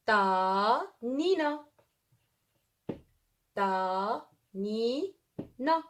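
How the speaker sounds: "Ta Nina" stretched out very slowly, each syllable apart; slow syllable demo. Shown as a demonstration. Teacher spells out the name for the class, very slow. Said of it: "J'écris un exemple avec T".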